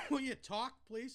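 talking through a burning mouth; struggling to speak after hot chips; mouth burning from spicy food. Real, not acted. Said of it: "my mouth is burning".

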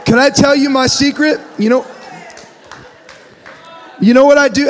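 high and loud, teasing a secret; loud playful reveal; excited confession. Real, not acted. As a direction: hype the crowd with a secret. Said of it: "excited confession to crowd".

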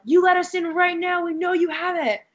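mock drunken yelling at a door; acted demand. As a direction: demand entry, acting it out at a high pitch. Acted demand while banging on door.

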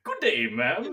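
a gentleman tipping his hat, a hint of old-timey; lightly voiced, polite old-fashioned greeting. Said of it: "you're talking in an old-timey voice".

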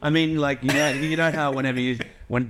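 ordinary talk

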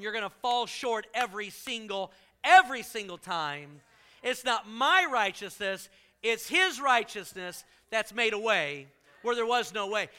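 loud, driving preaching; full-force sermon climax. Acted. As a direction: hammer it home from the pulpit.

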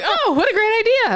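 high, loud sarcastic parent voice; mock naive parents. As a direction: gush like a clueless parent. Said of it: "our parents were like".

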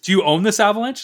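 high, loud mocking cop; the friend mimics a cop questioning him, high and loud. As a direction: sneer it like a suspicious cop. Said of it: "It's like".

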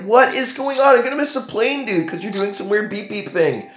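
high-pitched, incredulous complaint; exasperated rant. Retold or imitated. Traveller complains to the guard, high and incredulous. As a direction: voice shooting up in disbelief.

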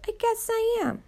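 put-on a boy stunned to be called a hero; amazed. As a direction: say it as if you can't believe it yourself. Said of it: "sounding amazed".

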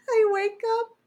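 breaks into high laughter mid-sentence; laughing. Real, not acted. Telling cohost the embarrassing nap story, laughing. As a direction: laugh out the punchline, pitch jumping up.

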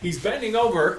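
high, loud commentator bit; playful excited play-by-play; mock announcer. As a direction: call it out like breaking action.

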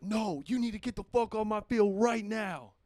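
high, slow mock cop yell; angry cop. Old cop ordering a fan off the field, high and slow. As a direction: play the furious old cop ordering him off. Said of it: "And he's like".

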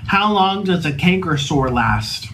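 slow, careful voice command; slow for Siri. Real, not acted. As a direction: speak slowly to the phone. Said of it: "Hey, Siri".

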